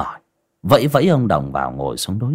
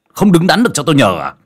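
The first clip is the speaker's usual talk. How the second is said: càng già càng không nên nết said loud and rising; angry louder scold; 7 semitones higher, 5 dB louder, faster than usual. Acted. Master scolding.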